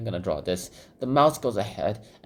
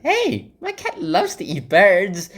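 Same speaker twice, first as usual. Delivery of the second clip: high, loud, slow sweet chatter; sweetly oblivious boast; Alice boasting to the birds, high and slow; cheerful girl voice; 11 semitones higher, 7 dB louder, slower than usual. Retold or imitated. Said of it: "She's like".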